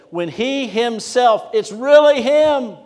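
"he himself" and "him" high, loud and drawn out; emphatic stress on HE. Shown as a demonstration. Preacher showing who the subject is, ringing emphasis on "he himself". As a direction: punch "he himself", then soar on "really him". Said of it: "putting the emphasis on the he".

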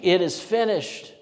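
slow, loud, drawn-out cry. Acted. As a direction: call it out with your last strength. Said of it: "crying out with a voice saying".

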